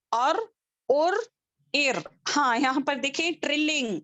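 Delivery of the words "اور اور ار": ار said with a rolled, vibrating r; trilled r. Shown as a demonstration. Teacher demonstrating the trill of ra. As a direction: roll the r. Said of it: "اب اس کو کیسے پڑھیں گے؟".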